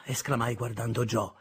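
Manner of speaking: ordinary delivery, nothing marked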